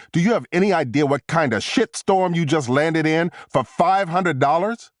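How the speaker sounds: high-pitched, outraged demand; angry disbelief. A narrator's reading. Agent ready to tear the suspect apart, angry disbelief. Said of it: "ready to tear the guy's face off".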